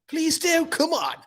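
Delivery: very high squeaky begging; put-on high-pitched wheedle; mock pleading. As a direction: plead playfully in a silly falsetto. Comic begging for likes.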